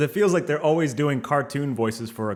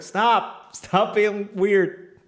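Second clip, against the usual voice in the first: exasperated; 7 semitones higher, 3 dB louder, much slower than usual. Retold or imitated. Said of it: "And it's like".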